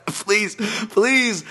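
pleading with listeners, laughing still; begging. Real, not acted. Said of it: "at least one of my listeners".

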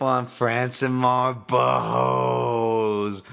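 sarcastic ring announcer introducing a boring fighter; mock hype sarcasm. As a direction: oversell the name with contempt. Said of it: "You can hear the fucking tone of my voice".

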